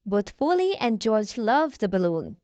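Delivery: plain reading voice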